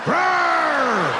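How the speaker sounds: high hammed-up roar; monster roar. Meth addict pops back up.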